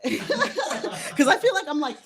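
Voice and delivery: high giggly laughing talk. Real, not acted. Laughing as she explains her breathing to viewers.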